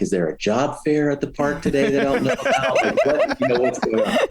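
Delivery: incredulous question broken up by laughter; chuckling mock disbelief at the idea; laughing sarcasm. Sarcastic retort to wife.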